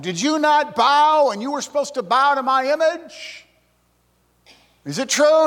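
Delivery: high-pitched, acted angry king's interrogation; Nebuchadnezzar yelling. King yelling at men who would not bow, acted rage. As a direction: raise the pitch sharply and demand answers. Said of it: "no doubt he's yelling at them".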